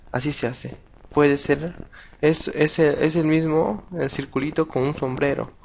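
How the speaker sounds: normal talking voice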